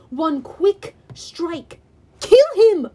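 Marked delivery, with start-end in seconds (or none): 2.2-2.9 loud, high fierce order; yell. A narrator's reading. Clarice urges hero to strike, fierce yell. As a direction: shout it urgently at a hesitating ally. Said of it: "Clarice yelled".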